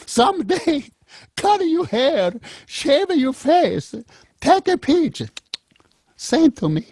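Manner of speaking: put-on very high, slow old grandmother pleading; grandma voice. Grandmother begging her grandson for a photo, very high slow voice. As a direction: coax him gently like a grandma. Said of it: "she goes".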